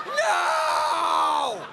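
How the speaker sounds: long hammed-up scream of refusal; drawn-out mock-horrified shout; child's protest wail. Child protesting a threatened holiday.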